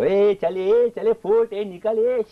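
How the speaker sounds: loud high jeering heckle; heckling crowd. Retold or imitated. College crowd jeering a newcomer on stage, loud high heckling. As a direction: shout down the performer. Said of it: "and they go".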